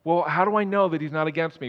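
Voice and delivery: ordinary talk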